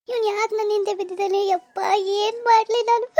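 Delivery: high-pitched helpless wail, building; acted distraught weeping; tearful despair. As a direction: cry it out in despair. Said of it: "ಯಾಕ್ ಅಳ್ತಿದ್ಯಾ?".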